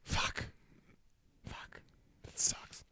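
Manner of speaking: very soft, almost voiceless reaction; hushed dismay. Real, not acted. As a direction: take the bad news in under your breath. Dismayed at news of rats.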